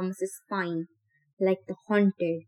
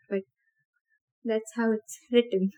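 usual speaking voice

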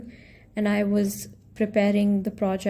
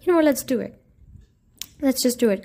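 louder, pumped self-talk; psyched-up self talk; 3 semitones higher, 3 dB louder, much faster than usual. Retold or imitated. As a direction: hype yourself up to go for it. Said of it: "but I'm like".

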